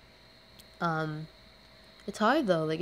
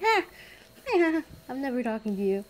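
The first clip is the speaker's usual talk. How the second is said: higher, flustered squeak; shy girl fleeing her crush, higher; mock shy panic; 6 semitones higher, 3 dB louder than usual. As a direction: flinch away bashfully.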